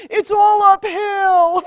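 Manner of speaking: slow, high, whining complaint; mock whinging. Healer imitating her whinging client, slow and high. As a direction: stretch it out, mock self-pity. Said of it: "And she's saying".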